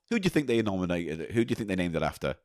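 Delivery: normal talking voice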